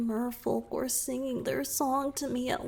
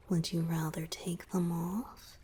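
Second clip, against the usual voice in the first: low teasing whisper; flirty whisper; 4 semitones lower than usual. Acted. Merman flirting with his rescuer in a whisper. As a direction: slip in a cheeky suggestion.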